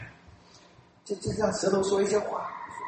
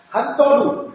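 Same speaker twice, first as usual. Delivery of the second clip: 含多鲁 said loud and high in a Japanese accent; 7 semitones higher, 7 dB louder, much slower than usual. Shown as a demonstration. Showing the Japanese word for steering wheel in a Japanese accent. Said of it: "汽车的方向盘".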